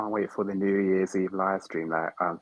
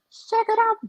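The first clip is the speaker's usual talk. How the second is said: tiny squeaky chipmunk voice, pitched way up; 8 dB louder than usual. Pretend. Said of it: "Should I do my chipmunk voice?".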